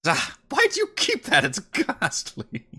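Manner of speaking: high, appalled exclamation; disgusted high voice. Acted. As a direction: squeal out the disgust. Character disgusted at gem.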